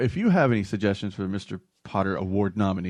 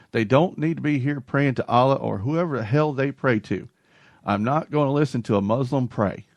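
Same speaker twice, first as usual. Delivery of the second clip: put-on Southern accent; 3 semitones higher than usual. Host quotes a county commissioner on prayers, Southern accent. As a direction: read it as a Southern county official. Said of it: "the Southern accent. I haven't done it".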